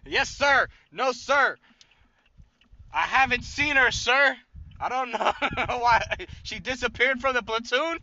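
high, loud "yes sir" to a drill sergeant; hammed-up recruit shouting at attention; mock soldier. Mock soldier answering an officer.

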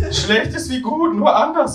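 very high, drawn-out flatmate voice; Hermine. Acted. Flatmate philosophising in the wrong figure's voice, very high. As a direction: lift it right up and take it slowly. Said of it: "mit der Stimme von Hermine".

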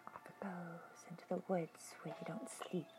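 hushed ominous whisper; spooky whisper. Pretend. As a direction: whisper it like a scary tale.

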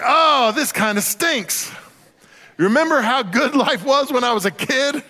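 high, hammed-up whine of a disillusioned adult; mock lament. Preacher voices adults hit by taxes, mock whining lament. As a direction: wail it like a grown-up missing childhood.

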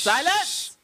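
shouted command. Real, not acted. Calling the room to order.

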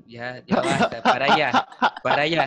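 laughing through a playful "stop it"; laughing protest. Real, not acted. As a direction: push the compliment away while laughing. Teased, laughing it off.

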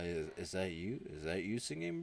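soft, halting question, rising at the end; hesitant questioning. Retold or imitated. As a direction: act out tentative disbelief. Man hesitantly questions singing brain.

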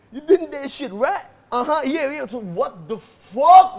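loud hammy American movie lines; overacted action star; Hollywood actor. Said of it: "aktor Transformers".

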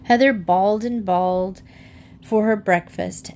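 ordinary delivery

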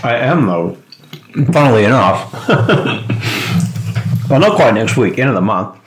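chewing while talking; mouth full. Real, not acted. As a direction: talk through a mouthful of food. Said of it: "sorry, I don't know if you can hear me chewing".

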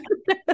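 short high burst of laughter; quick, high giggle. Real, not acted. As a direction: burst briefly into real laughter. Laughs about both choking up.

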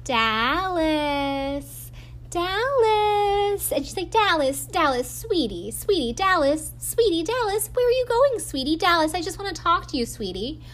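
high, sing-song saccharine calling of her name; mock sickly-sweet taunt. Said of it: "like, sweet".

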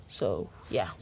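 mock deep voice. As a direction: drop into a deep goofy voice for a joke. Deep joke voice after rambling.

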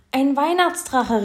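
high, loud, slow delighted cry; excited. A narrator's reading. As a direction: call it out in delight. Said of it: "rief Nico begeistert".

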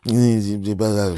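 ordinary talk

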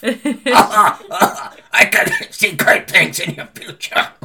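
raspy New Jersey astrologer, slow; smoker's rasp. Retold or imitated. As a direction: croak it like an old fortune teller. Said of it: "a sixty-year-old smoker's cough".

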